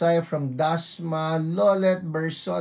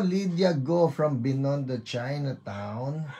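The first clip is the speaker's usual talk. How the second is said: usual speaking voice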